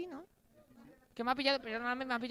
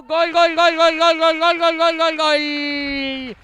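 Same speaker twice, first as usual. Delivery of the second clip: screaming goal call at full voice; breakneck goal commentary; 6 semitones higher, 21 dB louder, much slower than usual. Real, not acted. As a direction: roar the goal call, drawing it out. Penalty scored, goal celebration.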